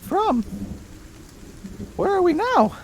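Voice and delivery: very high, odd little creature; squeaky creature voice. Acted. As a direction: squeak every line way up high. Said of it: "Sounds like a cat".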